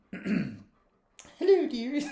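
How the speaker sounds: put-on high, old-lady warble; Missus Hayward voice. Man practising an old lady voice for his podcast. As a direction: channel Missus Doubtfire. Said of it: "think about Missus Doubtfire".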